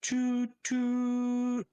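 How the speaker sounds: high, loud honking toot; air horn toot. Retold or imitated. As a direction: honk it out like a boat horn. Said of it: "which simulates an air horn".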